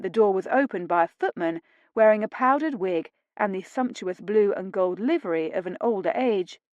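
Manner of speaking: usual speaking voice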